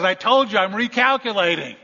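loud, high mock scolding; exasperated GPS. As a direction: play a GPS losing patience. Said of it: "And then finally she says".

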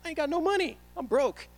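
high, loud comic protest; exasperated protest. Retold or imitated. Protests being a broke student.

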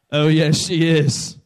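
loud, drawn-out comic confirmation; emphatic insistence. Retold or imitated. Father telling his son that mommy's a sinner too, emphatic insistence. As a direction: lean hard and slowly on every word.

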